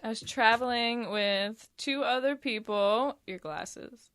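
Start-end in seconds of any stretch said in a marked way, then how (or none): 3.3-4.1 unvoiced quick whisper. Real, not acted. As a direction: whisper a quiet warning. Aside to friend, whispered.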